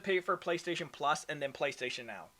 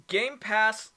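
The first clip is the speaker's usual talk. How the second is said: usual speaking voice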